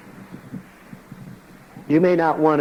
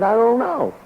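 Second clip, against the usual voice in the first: helpless I don't know; 9 semitones higher than usual. Retold or imitated. Helpless answer to brother.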